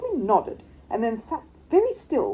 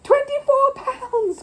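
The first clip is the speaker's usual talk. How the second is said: high, loud, slow wild bid; overexcited little bear shouting; cried wildly; 7 dB louder, much slower than usual. Acted. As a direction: burst out with the bid. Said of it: "cried Paddington wildly".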